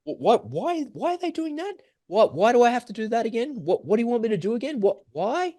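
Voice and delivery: mock a helpless person lost in panic; clueless panic. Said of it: "and you'd be like".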